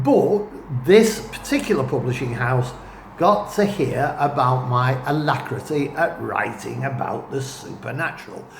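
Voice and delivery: ordinary delivery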